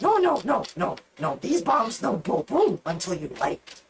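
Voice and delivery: high, broken-English goblin. Acted. Goblin girl assuring the captain the bombs are safe, high. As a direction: reassure in broken goblin talk.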